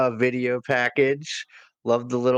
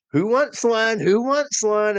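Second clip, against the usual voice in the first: teasing crowd call; 10 semitones higher, 3 dB louder than usual. Retold or imitated. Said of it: "he's like".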